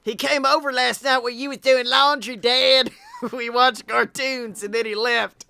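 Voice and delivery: Styles' son voice. Acted. Son telling Dad about the visitor, childish and giggly. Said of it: "That's his son".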